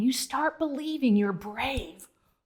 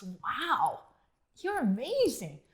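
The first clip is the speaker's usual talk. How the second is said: ordinary delivery, nothing marked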